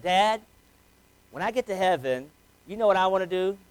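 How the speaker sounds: a dad lightly voicing his small daughter; little girl voice. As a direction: imitate a three-year-old full of excitement. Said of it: "three-year-old little voice".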